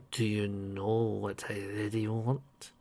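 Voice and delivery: low, slow, soft menace; sinister man voice. Acted. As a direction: draw it out darkly. Captor voice.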